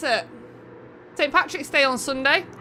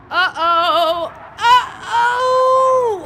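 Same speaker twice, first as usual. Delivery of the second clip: rising alarm; 9 semitones higher, 8 dB louder, much slower than usual. Real, not acted. Streamer watching the sabotage wheel, building to a loud alarmed cry.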